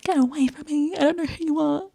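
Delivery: high-pitched mock panic; high, put-on damsel voice; mock frightened woman. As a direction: imitate her recoiling, pitched well up. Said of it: "But she's like".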